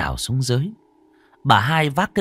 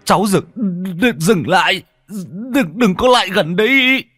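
halting plea rising to a high, loud cry; frightened stammering; 8 semitones higher, 4 dB louder than usual. A narrator's reading. Bác Tâm begs the dead girl to keep away, stammering. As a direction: stumble over the words in mounting panic. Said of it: "bác Tâm bây giờ cũng lắp bắp".

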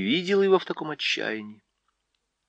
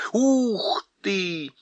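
high hooting cry of wonder; owl exclamation; 8 semitones higher than usual. A narrator's reading. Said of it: "воскликнула сова".

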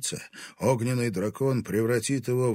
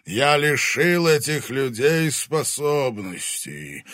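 loud, slow, high booming dragon; menacing fire dragon voice; booming arrogant dragon; 7 semitones higher, 9 dB louder, slower than usual. Acted. Dragon commands.

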